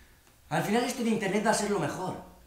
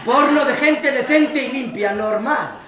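louder, slow, laughing through it; loud laughing insistence; 6 semitones higher, 11 dB louder, slower than usual. Acted. Insisting on decent porn, laughing.

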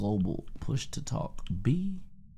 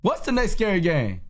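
higher, louder call; loud repeat; 9 semitones higher, 6 dB louder than usual. Real, not acted. Player fed up with tutorial steps, asks again louder. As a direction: shout it to the room. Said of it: "repeated louder".

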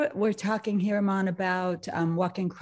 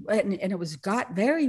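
normal talking voice

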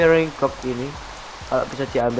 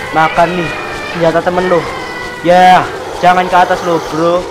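louder, jeering taunt at the boss; 4 semitones higher, 8 dB louder than usual. Real, not acted. Gamer taunts the boss he is fighting, loud jeering. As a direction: rub it in the boss's face, loudly.

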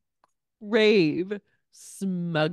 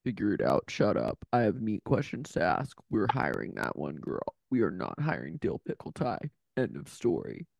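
much lower, growled orders; harsh barking commands; 7 semitones lower, 3 dB quieter than usual. Retold or imitated. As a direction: bark orders like a strict boss. Penny barking orders again.

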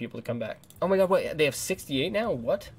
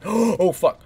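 startled gasp; 7 semitones higher, 8 dB louder than usual. Real, not acted. Gamer spots an ambush and gasps out a loud curse.

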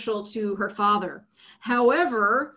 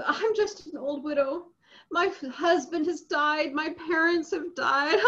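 higher, whiny widow's lament with a catch of crying; mock crying for pity; 6 semitones higher than usual. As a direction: play the pitiful old widow, half-teasing. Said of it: "she's crying for our pity".

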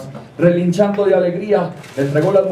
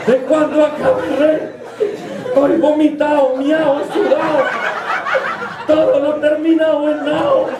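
high-pitched, hammed-up tearful complaint; whiny wailing; 6 semitones higher than usual. Shaken envoy complaining to his king, whiny wailing. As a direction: wail the grievances in a raised voice. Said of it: "el enviado no hacía más que chillar".

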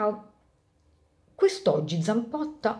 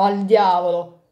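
louder, slow "Al diavolo!"; shouted curse; 7 dB louder than usual. Acted. Clerks playing football shouting as they kick, loud curse.

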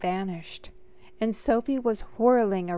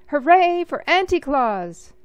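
much higher, much louder cheer; 6 semitones higher, 10 dB louder than usual. A narrator's reading. The ballroom crowd cheering for Auntie Claus. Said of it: "Everybody cheered".

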